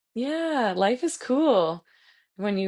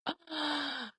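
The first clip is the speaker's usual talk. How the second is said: a quiet, moved gasp; a small breathless gasp of being touched; soft gasp; 8 semitones higher, 10 dB quieter than usual. Real, not acted. Instructor moved by a student's message, soft gasp.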